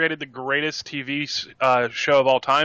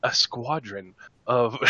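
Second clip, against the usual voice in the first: nature documentary; 3 semitones lower, 5 dB quieter, slower than usual. Shown as a demonstration. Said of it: "get the super soft narrator-y voice".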